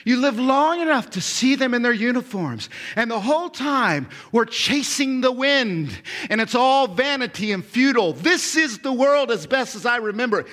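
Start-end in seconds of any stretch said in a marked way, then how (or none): none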